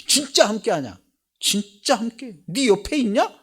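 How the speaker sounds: high, loud doubting questions; a mocking sceptic pressing hard; sceptical challenge. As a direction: needle him like a doubter.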